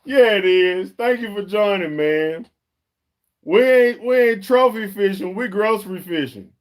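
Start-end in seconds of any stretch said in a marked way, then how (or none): 3.4-6.5 much louder, higher excited declaration; proud loud joke about fishing. Real, not acted. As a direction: hype it up. Excited reading comment aloud.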